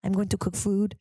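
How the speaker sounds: hammed-up chef, loosely French accent; proud chef in a muddled French accent; comic French-ish accent, carefully worded; vaguely French accent. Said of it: "Maybe he's French".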